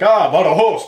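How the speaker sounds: high, loud Spanish Badajoz from the ticket clerk; Spanish accent. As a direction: beam it out like a cheerful local. Voices the ticket guy.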